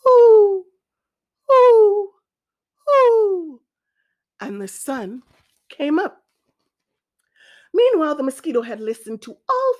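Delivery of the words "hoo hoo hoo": high, loud owl hoots; long falling hoots, spaced apart. A narrator's reading. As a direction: hoot out to wake the sun. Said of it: "toward the east and hooted".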